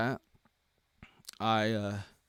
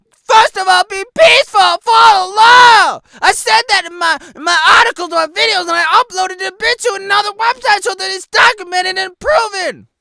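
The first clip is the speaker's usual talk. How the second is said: yelling furiously, much higher and louder; furious shout; 13 semitones higher, 12 dB louder than usual. Real, not acted. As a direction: scream out the outrage at the ban. Furious rant over his ban.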